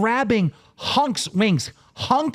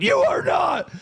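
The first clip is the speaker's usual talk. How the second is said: Boogie screaming; 10 semitones higher than usual. Retold or imitated. Quoting a friend screaming over the phone, high mock scream. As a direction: hammer out a frantic, imitated scream down the line. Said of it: "screaming and fucking yelling".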